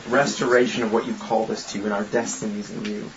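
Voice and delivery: quiet, low prayer voice. Real, not acted. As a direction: pray softly to God. Opening prayer.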